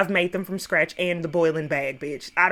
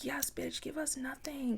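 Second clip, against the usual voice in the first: hushed sassy whisper; giddy under-breath praise; whispered cheer; 5 semitones higher, 15 dB quieter than usual. Retold or imitated. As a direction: whisper it like egging a friend on. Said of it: "I was like".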